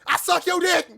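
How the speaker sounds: loud high yell; screaming. Retold or imitated. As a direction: scream it as loud as you can. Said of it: "scream as loud as I can".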